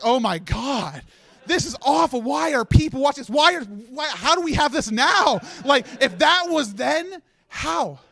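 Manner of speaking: appalled exasperation. Acted. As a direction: despair comically at your old self. Appalled reaction to own old clip.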